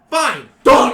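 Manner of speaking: put-on loud defiant teen. GM voices teen.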